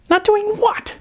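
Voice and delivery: sharp high disbelief; incredulous. Acted. Sarah firing back at Mitchell, high incredulous question. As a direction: leap up in shocked disbelief.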